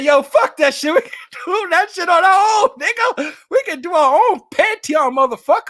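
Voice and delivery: high, loud hammed-up shouting; mock defiant outburst. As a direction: crow it like a rebellious hothead, half laughing. Acted quote of defiant colleague.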